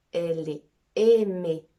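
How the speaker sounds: ordinary delivery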